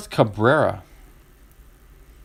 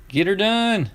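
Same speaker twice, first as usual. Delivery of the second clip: higher Southern catchphrase voice; Larry the Cable Guy catchphrase; 7 semitones higher, slower than usual. Retold or imitated. Quoting the comedian's catchphrase to viewers, Southern twang. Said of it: "Larry the Cable Guy".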